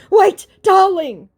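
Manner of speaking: very high, slow, loud mock plea; mock his drawn-out sweet-talk; pleading impostor. Impostor begging her to wait, mock pleading.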